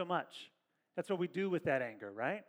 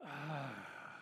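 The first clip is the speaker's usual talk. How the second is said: long, deep groaning sigh; a deep sigh showing how Jesus sighed; 5 semitones lower, 10 dB quieter than usual. Shown as a demonstration. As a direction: let out a heavy weary sigh. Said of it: "Jesus went like this".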